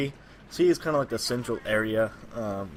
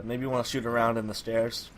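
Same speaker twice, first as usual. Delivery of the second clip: ordinary talk, nothing marked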